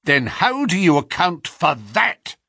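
accusing cry. A narrator's reading. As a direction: throw the evidence at him. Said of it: "cried Hopkins".

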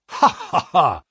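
mock laugh. A narrator's reading. Pinner laughs at doubt.

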